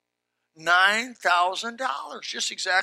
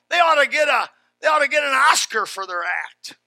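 raised, loud preaching voice; emphatic preaching; 5 semitones higher, 5 dB louder than usual. Real, not acted. Pastor admitting he sometimes gets taken, loud emphatic preaching. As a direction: punch out the admission loudly, pulpit style. Preacher rises to full force.

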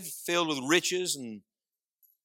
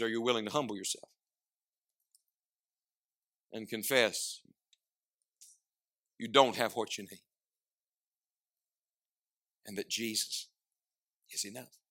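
much softer, dropping to near hush; intimate appeal; 3 semitones lower, 5 dB quieter than usual. Acted. Preacher quietly appealing to the congregation, hushed. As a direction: draw them in quietly.

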